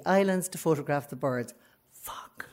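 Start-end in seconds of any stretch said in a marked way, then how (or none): 1.9-2.5 whispered curse. Real, not acted. Realising her father came.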